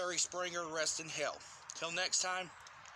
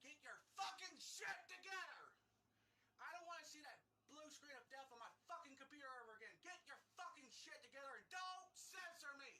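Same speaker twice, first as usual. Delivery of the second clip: furious rant; 9 semitones higher, 16 dB quieter than usual. Real, not acted. Screaming at Microsoft about the blue screen, furious yell. Raging at Microsoft over crash.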